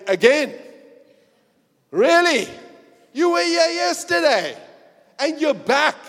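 high, loud, incredulous judge; a judge who can't believe you're back; exasperated judge. Acted. Judge scolding the repeat offender, high and exasperated.

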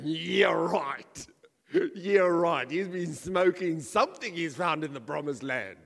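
loud mocking disbelief, scoffing; sarcastic scoffing. Preacher imagining himself scoffing at Joshua, loud sarcastic disbelief. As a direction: scoff at the plan like it's nonsense. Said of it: "I would have been going".

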